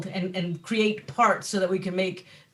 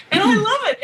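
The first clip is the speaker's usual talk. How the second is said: gushing; 12 semitones higher, 8 dB louder, faster than usual. Real, not acted. Gushing about her bike, laughing.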